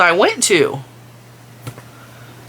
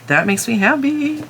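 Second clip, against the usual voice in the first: higher happy squeal; 5 semitones higher than usual. Real, not acted. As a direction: lift "That makes me happy" up high, in a sing-song voice.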